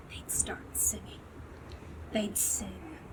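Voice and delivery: hushed whisper. A narrator's reading. Narrator whispering as the Whos begin to sing. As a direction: whisper it softly.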